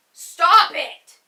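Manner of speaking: loud sharp stop it; real loud yell at the pet; sudden yell. Real, not acted. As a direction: bark it out at the pet.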